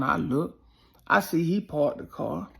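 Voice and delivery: ordinary talk, nothing marked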